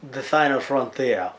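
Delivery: slow mock French accent; badly faked French accent. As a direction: draw it out in a fake French accent. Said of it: "if you think my French accent is bad".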